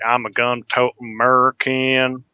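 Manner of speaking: lightly mock Southern, slow; slow hammy Southern American; slow southern drawl. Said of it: "southern drawl, slow".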